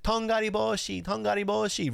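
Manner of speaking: high, excited little-kid voice imitating her son; high playful toddler chant. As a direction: chant it like a toddler running around the house. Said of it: "he'd be like".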